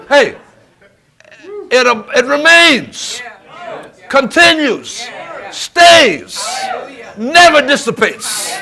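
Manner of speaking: high, loud, drawn-out preacher's cry; real fervent pulpit shouting; full-force preaching shout. Real, not acted. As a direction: shout each point to rouse the church. Preacher erupts repeating the definition.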